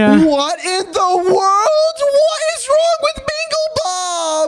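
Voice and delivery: drawn-out, high-pitched cry of dismay; horrified dismay. Acted. Horrified at his turtle's new look.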